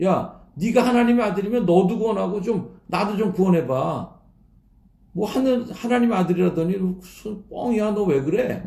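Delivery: bandit's contemptuous ridicule; mocking jeer. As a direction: sneer at his claim to be God's son. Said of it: "이렇게 막 조롱하는 거거든요".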